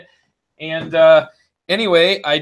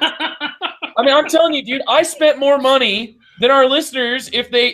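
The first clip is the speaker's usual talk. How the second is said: excited; 6 semitones higher than usual. Pretend. Hyped pitch after infomercial remark.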